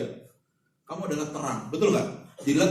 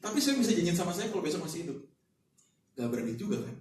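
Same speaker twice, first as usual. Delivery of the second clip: normal talking voice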